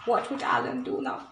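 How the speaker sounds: soft breathy whisper of "What would Alan do now?". Shown as a demonstration. Reader drawing the children in with a secret whisper. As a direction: lean in and whisper the question. Repeated question, second whispered.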